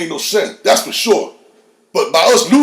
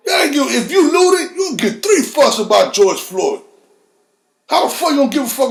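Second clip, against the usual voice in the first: angry outburst; 6 semitones higher than usual. Real, not acted. Angry rant about looters.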